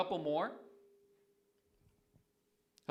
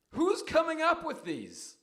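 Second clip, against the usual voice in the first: exasperated; 11 semitones higher, 3 dB louder than usual. Real, not acted. Host reacts to prank segment.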